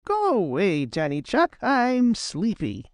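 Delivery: sleepy, mildly cross rabbit; a wee bit cross. A narrator's reading. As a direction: grumble a little, drawing out the end. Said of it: "his voice sounded just a wee bit cross".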